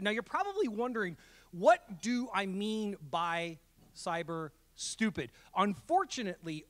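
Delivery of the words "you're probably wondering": ordinary talk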